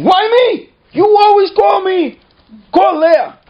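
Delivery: whining shout; whiny yell. Retold or imitated. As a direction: yell like a put-upon kid. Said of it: "Moshe starts yelling".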